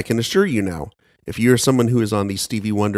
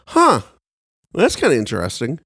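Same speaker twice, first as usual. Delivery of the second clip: intrigued; 3 semitones higher than usual. Retold or imitated.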